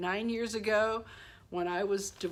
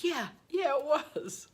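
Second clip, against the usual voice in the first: gleeful yes; 9 semitones higher, 4 dB louder, slower than usual. Retold or imitated. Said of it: "I'm like".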